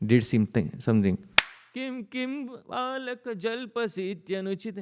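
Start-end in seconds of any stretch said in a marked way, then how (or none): 1.7-4.8 scolding mother. Acted. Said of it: "Yashoda scolding in verse".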